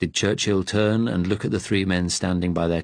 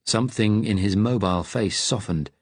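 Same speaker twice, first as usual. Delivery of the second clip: plain reading voice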